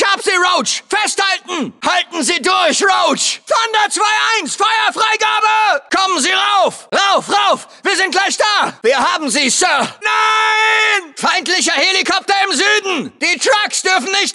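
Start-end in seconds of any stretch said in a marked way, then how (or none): 3.5-5.8 raised, drawn-out battle cry; urgent radio shout. Acted. Soldier calls Thunder zwei eins to open fire, urgent shout. 9.7-11.1 long, raised cry of "no"; desperate shout. Acted. Soldier sees disaster strike the squad, long desperate shout.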